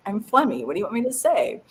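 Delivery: ordinary talk, nothing marked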